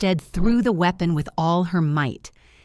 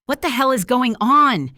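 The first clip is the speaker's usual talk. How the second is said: "What the hell is going on?" snapped high and loud; a loud, furious demand at the woman; angry snap; 7 semitones higher, 5 dB louder than usual. A narrator's reading. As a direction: bite the question out at her. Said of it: "he snapped at her".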